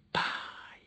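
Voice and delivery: soft whispered call; father's spirit greets his son, whispered. Retold or imitated. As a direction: breathe the word like a spirit's greeting. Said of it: "Ele disse assim".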